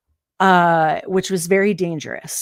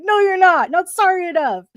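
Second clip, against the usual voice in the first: high, loud mocking retort; scornful yell; 12 semitones higher, 7 dB louder than usual. Employee rejecting the customer's apology, loud scorn. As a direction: throw his apology back at him. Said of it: "I'm like".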